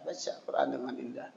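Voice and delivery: breathy unvoiced whisper. Real, not acted. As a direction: drop to a hushed whisper. Soft aside to the congregation. (berbisik pelan)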